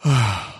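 big audible exhale; weary sigh, put on for the story; heavy acted sigh. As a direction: sigh as a brain ready to switch off. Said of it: "her brain said".

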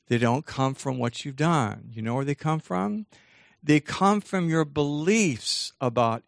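slow teaching delivery with long pauses; deliberately slow. Real, not acted. Teacher explaining where feelings come from, slowly. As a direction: spell it out slowly, phrase by phrase. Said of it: "I'll say this kind of slowly".

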